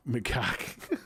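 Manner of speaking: "macaque" pitched in a mock Boston twang; half-laughing Boston "Macaque". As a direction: echo the text-to-speech accent. Mimics the donation voice again.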